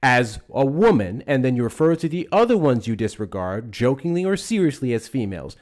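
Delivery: real angry rebuke, loud and emphatic; upset. Real, not acted. Host scolding caller for how he refers to women, upset. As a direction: hammer it out, loud and indignant. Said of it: "don't get all upset now".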